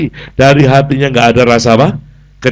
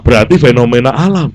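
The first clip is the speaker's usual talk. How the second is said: normal talking voice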